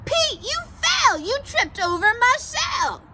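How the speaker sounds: high, upset turtle voice; Turtle's voice. Acted. Turtle telling Pete he fell, high and upset. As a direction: squeal it like a fussed turtle.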